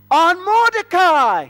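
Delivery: loud, high, drawn-out royal order; commanding proclamation. Acted. King orders Haman to honor Mordecai, commanding proclamation. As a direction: proclaim the name slowly and loudly.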